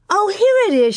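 loud, high cry of discovery; excited cry. A narrator's reading. Girl finding the next part of the story, excited cry. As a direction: cry out as you find it, pitch soaring. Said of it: "she cried".

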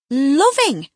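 loud, scornful "Loving?". A narrator's reading. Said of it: "as scornfully as I could utter the word".

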